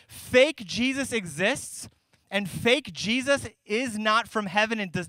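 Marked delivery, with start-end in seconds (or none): 0.1-1.9 drawn out slowly, every word weighted; slow it right down and stress it; slow emphatic statement. Shown as a demonstration. Pastor stating his first point to the church, slow and emphatic. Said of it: "here's the first thing I wanna say".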